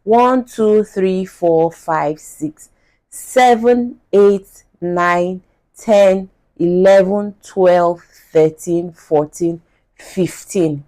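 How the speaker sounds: each number spoken on its own, building the count; counting it out slowly. Shown as a demonstration. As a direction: count up one by one to drive the point home. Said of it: "Let me spell it out".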